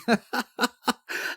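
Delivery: short, high burst of laughter; high-pitched acted laugh; amused laughter. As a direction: laugh on after the joke. Character keeps laughing.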